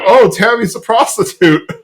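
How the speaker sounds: mock stunned discovery; sudden realization. Host retelling Hank's flashback realization, mock shock. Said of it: "finally has the realization that".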